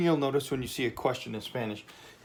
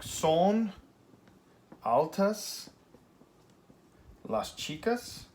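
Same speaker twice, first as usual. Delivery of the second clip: slow demo question; 6 semitones higher, much slower than usual. Shown as a demonstration. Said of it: "Here's my subject".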